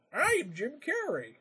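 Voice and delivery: high, slow, hammed-up showing off; Jim Carrey. Mocking a comic actor's mugging, high drawn-out self-announcement. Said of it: "he just started going".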